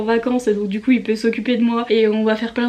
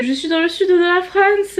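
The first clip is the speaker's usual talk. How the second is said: a light put-on southern French accent; hammed-up southern French accent; mock southern French accent; 7 semitones higher than usual. Vlogger repeating her line to southerners, in a mock southern French accent. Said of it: "mon accent pourri".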